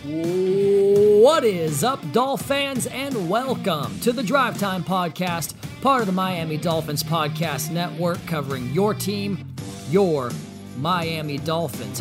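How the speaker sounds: loud, drawn-out hype opener; podcast host intro. Acted. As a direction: open the show like a radio host.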